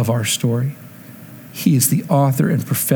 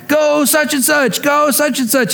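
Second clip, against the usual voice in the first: cheering on; 10 semitones higher than usual. Retold or imitated. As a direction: call it out like a fan at the pool. Cheering a boy swimming.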